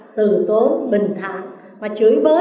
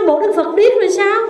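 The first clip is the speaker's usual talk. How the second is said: higher, sneering jeer at a silent Buddha; mock him for being deaf, rising in anger; the Brahmin taunting the Buddha, angry and high; 7 semitones higher than usual. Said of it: "Ông tức quá, ông nói".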